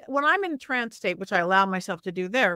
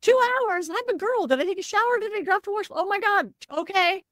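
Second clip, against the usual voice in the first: frantic, much higher squeal of panic; panicked high voice; 10 semitones higher than usual. Retold or imitated. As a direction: fluster like a girl given two hours' notice. Retold panic at short notice.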